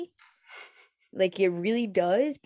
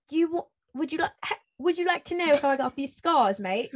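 high-pitched British Joker; playful British villain impression; British accent; 6 semitones higher than usual. Shown as a demonstration. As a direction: put on a hammy British voice. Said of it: "Or what if I was literally British?".

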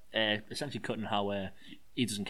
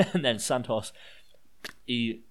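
ordinary talk, nothing marked

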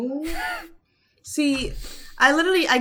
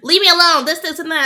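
raised, high mock irritation; nasty retold outburst; 6 semitones higher, 7 dB louder than usual. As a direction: imitate him crossly brushing people away. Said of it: "He was real nasty about it".

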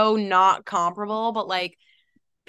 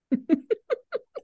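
high giggle; 11 semitones higher than usual. Real, not acted. Laughing at her own accent bit.

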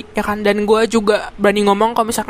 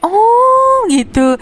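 long drawn-out high 'oh'; hammed-up dawning understanding, loud and slow; mock realization; 12 semitones higher, 5 dB louder, much slower than usual. Interviewer finally understands the explanation, exaggerated mock realization.

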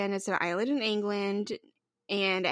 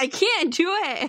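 high laughing protest; 8 semitones higher, 6 dB louder than usual. Real, not acted. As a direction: laugh through it. Laughing at request.

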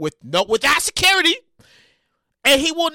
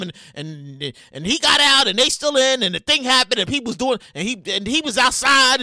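ordinary talk

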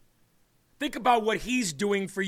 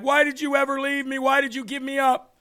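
put-on higher, pleading accusation from an abandoned child; hurt accusation; 5 semitones higher than usual. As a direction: throw the question at the parent who left. Angry orphan confronts parent.

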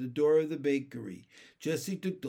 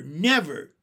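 the second "never" louder still, falling hard; emphatic never; 8 dB louder than usual. Acted. Henry to Jessie, vowing to keep Benny, a louder final "never". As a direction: hit the repeated "never" harder.

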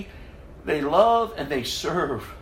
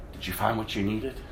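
soft inner voice; 9 semitones lower, 7 dB quieter than usual. Retold or imitated. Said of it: "The Holy Spirit said".

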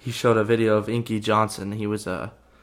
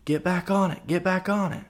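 imitating a dad yelling; 9 semitones higher than usual. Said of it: "yelled at his son".

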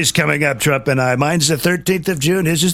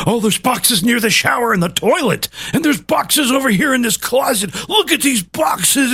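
mock outrage; 8 semitones higher than usual. Mocking agents who found boxes at Mar-a-Lago. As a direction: ham up shocked investigators. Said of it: "Just see 'em".

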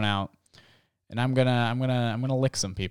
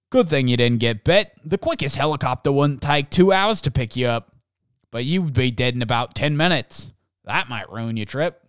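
usual speaking voice

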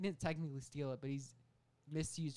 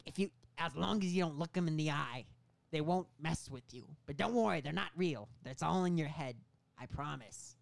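Willem Dafoe; 2 semitones higher, 5 dB louder than usual. Acted. Meth dealer reassuring his customer about goblins, Willem Dafoe impression. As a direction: give a hammed-up cautionary reassurance. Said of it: "Willem Dafoe goes on to explain".

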